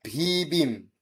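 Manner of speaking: slow "ভি বিম", bh first, b second; pronunciation demo. Shown as a demonstration. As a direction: correct the order in a stretched "ভি বিম". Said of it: "আবার উল্টা হচ্ছে".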